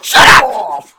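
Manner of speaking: loud laughing shout. Real, not acted. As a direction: yell it out at your friends. Playful outburst at teasing friends.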